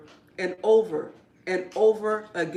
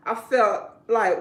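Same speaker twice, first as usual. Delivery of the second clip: ordinary delivery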